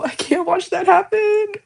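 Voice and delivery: normal talking voice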